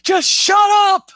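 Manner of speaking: loud shrill scream of shut up, building. Acted. Mother screams at something unseen, loud scream. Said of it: "she's screaming".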